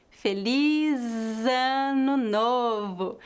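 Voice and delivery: slow "Feliz Ano Novo", long buzzing Z into "Ano"; linked Z. Shown as a demonstration. Teacher exaggerating the link, "Feliz Ano" joined by a voiced Z. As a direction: drag out the Z joining "Feliz" to "Ano". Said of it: "a letra Z deve ser pronunciada como Z".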